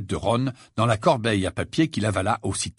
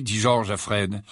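ordinary delivery

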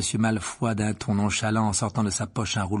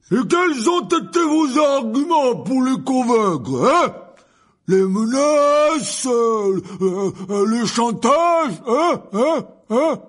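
much higher, much louder, very slow roar; furious shouting Hagrid; 14 semitones higher, 10 dB louder, much slower than usual. A narrator's reading. Hagrid shouts at Malfoy over the council vote. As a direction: bellow the accusation. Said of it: "ne pas crier comme ça".

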